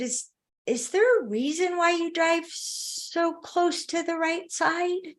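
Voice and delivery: slow and quiet, tiptoeing around criticism; careful tentative question. Retold or imitated. Wife carefully questions husband's driving, slow and tentative. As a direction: ask gently so it can't sting. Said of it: "That was the best I could come up with".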